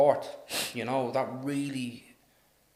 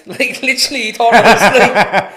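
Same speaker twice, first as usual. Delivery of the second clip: high laughing delivery; telling the story while laughing; 10 semitones higher, 16 dB louder than usual. Real, not acted. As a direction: talk through laughter.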